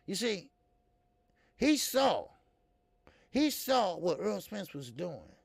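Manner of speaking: ordinary talk, nothing marked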